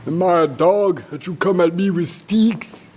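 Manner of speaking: indignant hammed-up taunt; Goliath's voice. Storyteller as Goliath insulted by David's sticks, scornful bluster. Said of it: "Goliath sees David and he says".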